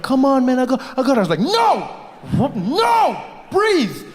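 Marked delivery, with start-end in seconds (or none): 0.0-1.2 high, loud, urgent pleading; pleading body. Retold or imitated. Body begging to throw up, high and urgent. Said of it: "My body was like". 1.4-4.1 very high, loud refusal; desperate command. Retold or imitated. Man ordering his body not to vomit, high shout.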